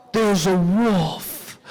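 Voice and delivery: low, slow realisation of danger; understated horrified discovery; stunned dread. Acted. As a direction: let it sink in slowly after a gasp. Boy discovers a real wolf.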